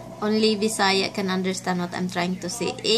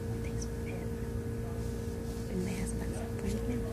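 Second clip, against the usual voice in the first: soft whisper in a waiting room; 9 dB quieter than usual. Real, not acted. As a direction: hush it so others won't hear. Whispering in doctor's office.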